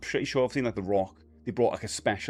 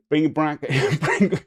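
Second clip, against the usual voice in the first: louder and higher, laughing through it; 5 semitones higher, 6 dB louder, slower than usual. Real, not acted. Catching himself mid-joke, laughing. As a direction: laugh as you say it.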